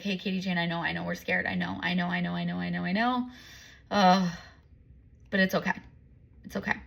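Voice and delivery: hushed, tender reassurance to her scared self; soft self-comfort. Retold or imitated. Alone on the couch after crying, she soothes herself with hushed tender reassurance. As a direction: cradle your own fear with quiet soothing words.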